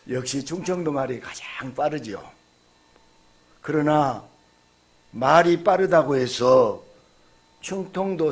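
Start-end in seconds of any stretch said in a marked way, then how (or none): none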